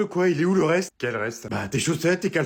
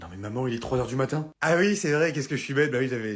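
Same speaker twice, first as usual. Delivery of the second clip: usual speaking voice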